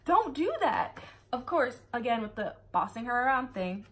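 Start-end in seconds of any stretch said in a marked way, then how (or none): 0.0-1.0 higher, louder, a hint of a frantic boyfriend; panicked Galen. Galen begging Emma to stop, high mock panic. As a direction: plead with her not to get hurt, pitched up. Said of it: "and he's like".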